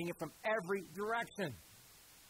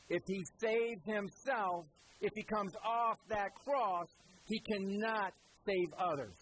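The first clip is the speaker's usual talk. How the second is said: slow emphatic; 4 semitones higher, slower than usual. Acted. Explaining what mockers miss.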